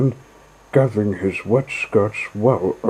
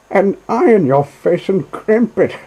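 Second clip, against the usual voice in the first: much higher, louder taunting barge woman; jeering call; 10 semitones higher, 5 dB louder than usual. Acted. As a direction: call out mockingly. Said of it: "she called out".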